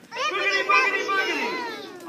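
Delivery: high, loud magic word chant; kids party magician. Shown as a demonstration. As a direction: wiggle fingers and chant it big. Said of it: "say the magic word".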